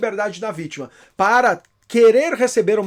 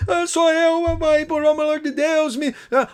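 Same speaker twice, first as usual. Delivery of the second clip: higher, slower, tearful plea; pleading son; 7 semitones higher than usual. Retold or imitated. Fake son begging his mother for help, tearful. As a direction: beg like a frightened kidnapped son.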